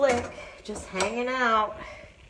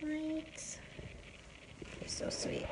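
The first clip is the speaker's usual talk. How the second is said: whispering; 10 semitones higher, 18 dB quieter, slower than usual. Real, not acted. Soft talk to cat.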